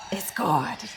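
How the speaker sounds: hushed, breathy whisper of praise. Real, not acted. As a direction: whisper it like a secret. Repeats her praise, second whispered.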